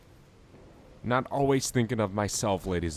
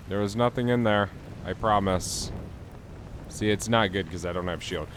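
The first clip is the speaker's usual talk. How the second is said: ordinary talk, nothing marked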